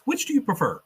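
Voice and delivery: Peggy's plain first asking; quoting Peggy. Retold or imitated. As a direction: ask it like a nosy reporter. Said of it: "she goes".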